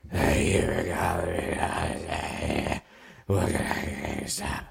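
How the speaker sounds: low, slow croak with a sick throat; barely able to talk. Retold or imitated. Answering the store phone with a wrecked, croaking voice. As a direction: force the words out through bronchitis. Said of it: "I'm barely able to talk".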